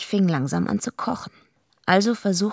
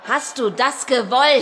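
slow, higher shouted accusation; shouting; 6 semitones higher, 3 dB louder than usual. Acted. Eva confronts Goldie about the gang, shouting. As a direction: hurl the question at her. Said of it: "Schrei mich nicht an. Immer schreist du mich an".

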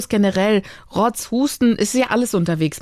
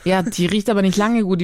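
normal talking voice

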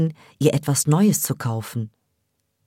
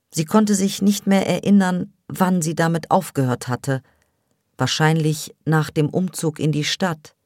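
usual speaking voice

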